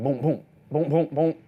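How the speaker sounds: quick punchy boom boom of a dribble; bouncing ball sounds. Retold or imitated. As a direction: beat out the dribble with your mouth.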